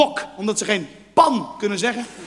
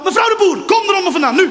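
high, loud, impatient pet call; scolding shout, pitched high; calling outside; 7 semitones higher, 5 dB louder than usual. Acted. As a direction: order the cat out from under a car. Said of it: "als je ze buiten gaat roepen".

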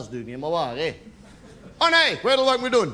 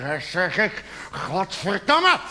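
boiling over; slower than usual. Acted. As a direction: mutter building into a shouted curse. Self-calming breaks into outburst.

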